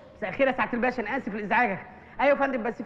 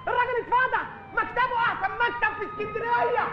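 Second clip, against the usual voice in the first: mock public outcry; 9 semitones higher than usual. Doorman shaming his boss to the street, high mock wail.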